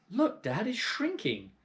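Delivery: put-on high startled boy voice; excited boy. Boy alerting his dad, high and excited. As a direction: point it out, alarmed and high. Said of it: "George said".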